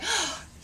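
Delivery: big theatrical gasp; mock astonished gasp. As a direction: gasp as if amazed by the offer. Acting out her reaction to the offer.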